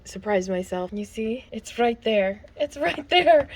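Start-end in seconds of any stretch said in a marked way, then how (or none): 2.6-3.5 higher, louder, thrilled repeat; calling it out in delight; excited. Real, not acted. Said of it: "So excited".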